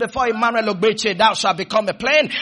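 fervent declamatory preaching; full-flow preaching. Real, not acted.

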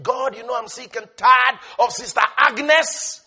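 fed-up complaint rising to a loud cry on tired; mock exasperated prayer. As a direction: whine to God, then burst out. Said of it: "He's not busy saying".

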